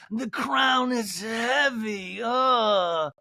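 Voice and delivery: weary queen declaring her burden; a hammed-up Lisa Vanderpump, drawn out and grand; Lisa Vanderpump impression. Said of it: "Lisa Vanderpump, Beverly Hills".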